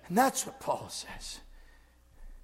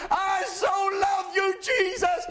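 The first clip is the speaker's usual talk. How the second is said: high, slow, shouted cries of love to Jesus; shouted adoration; 14 semitones higher, 6 dB louder, slower than usual. Acted. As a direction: cry it out like raised hands in worship. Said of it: "reach out and just express a hallelujah".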